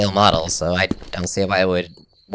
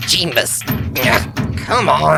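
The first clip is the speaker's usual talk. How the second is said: frustrated groan; 8 semitones higher, 4 dB louder, much slower than usual. Acted. Ro frustrated with stuck trap.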